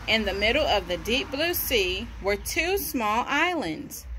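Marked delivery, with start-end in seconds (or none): none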